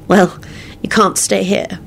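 slow, high, regretful; sad. A narrator's reading. Woman telling man he cannot stay, sad. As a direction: let him down sadly, taking time. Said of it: "she said sadly".

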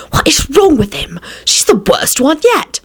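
exasperated, high-pitched hiss at a companion. A narrator's reading. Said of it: "Elizabeth hissed at Rosie".

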